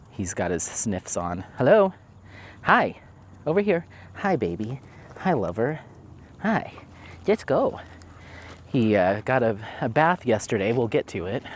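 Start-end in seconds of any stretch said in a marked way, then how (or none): 1.6-7.8 high pet-talk greeting. Real, not acted. Greeting and coaxing the dog.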